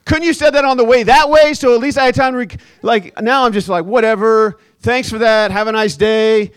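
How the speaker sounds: loud, high, sarcastic frustration; annoyed runner griping about the neighbor; exasperated rant. Retold or imitated. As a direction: vent it with mock outrage.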